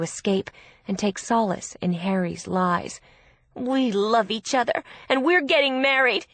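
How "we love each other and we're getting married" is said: loud, higher-pitched stubborn protest; upset, emphatic defence; defiant insistence. A narrator's reading. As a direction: insist against all argument. Bride defiantly defends the marriage.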